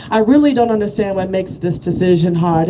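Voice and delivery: ordinary talk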